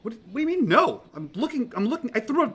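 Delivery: high-pitched flustered protest; stumbling over the words in high agitation; frantic disbelief. Acted. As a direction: argue back, incredulous and high. Mitch disbelieves his friends' denial.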